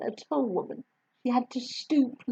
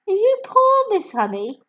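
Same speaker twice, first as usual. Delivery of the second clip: put-on much higher, louder child's voice; little girl voice; 12 semitones higher, 6 dB louder than usual. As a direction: answer like an eager small girl. Matilda voiced.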